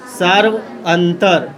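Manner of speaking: "सार्वंतर" drawn out in two parts, "सार्व" then "अंतर"; slow breakdown. Shown as a demonstration. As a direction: split "सार्वंतर" slowly for the class, falling at the end. Breaks the term down for students. ("सार्वंतर" को धीरे-धीरे "सार्व" और "अंतर" में तोड़कर)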